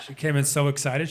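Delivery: usual speaking voice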